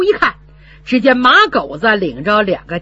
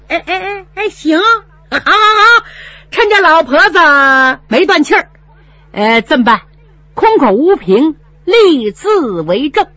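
gloating steward; 7 semitones higher, 6 dB louder than usual. Acted. Steward gloats, demands contract.

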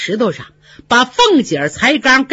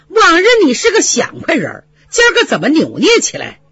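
impatient lady; 8 semitones higher, faster than usual. Acted. 邢夫人 presses Yuanyang impatiently.

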